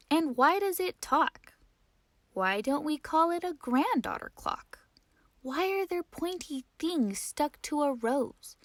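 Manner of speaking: put-on high, eager little girl's questions; curious child voice. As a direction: pile up the whys like a toddler. Child Ada asking question after question.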